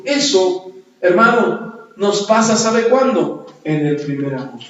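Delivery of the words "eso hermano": ordinary delivery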